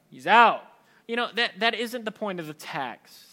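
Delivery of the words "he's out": barked dismissal, much louder; mock loud verdict. The theology man ruling a believer out, loud. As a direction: throw him out with one word.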